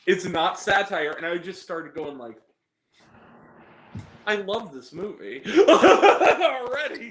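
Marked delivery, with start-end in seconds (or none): 4.3-7.1 louder, higher, bursting into laughter; delighted laughing. Retold or imitated. Viewer falling for an absurd movie, laughing with delight. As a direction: drag it out and crack up.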